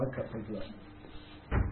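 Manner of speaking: slow hushed whisper, owning up; whispered confession. Retold or imitated. Preacher admits cutting drivers up, whispered. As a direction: breathe it out like a guilty secret. Admitting own bad driving.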